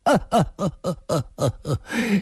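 long, cold staccato laugh; cold sneering laugh. A narrator's reading. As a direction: laugh coldly in a string of bursts. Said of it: "易明堂冷笑了一声".

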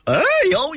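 high, sudden cry of pain; dying yelp. Acted. Villain struck at a death point, high pained yelp. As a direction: yelp as the fatal blow lands. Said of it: "一声，全身颤抖了一下".

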